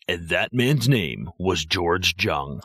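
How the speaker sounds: slow, measured audiobook narration with a hard-J Jung; audiobook narrator. Retold or imitated. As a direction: imitate a deliberate audiobook reader. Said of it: "like, an audiobook on him".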